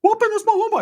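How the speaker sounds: very high put-on cousin voice; Ricky. Cousin sticking up for his homeboy, very high mock voice. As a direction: plead in a mock falsetto. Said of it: "to my cousin Ricky's like".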